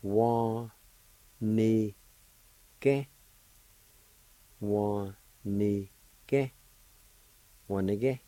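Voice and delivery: Wa-ni-ke said as three clear separate syllables; teacher modelling Wa-ni-ke for students; syllable-by-syllable Cherokee word. Shown as a demonstration. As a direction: pronounce Wa-ni-ke slowly for learners. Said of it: "It's pronounced like this".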